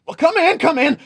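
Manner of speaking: lightly voiced innkeeper; hurried innkeeper. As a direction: hurry them inside. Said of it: "turns to you and says".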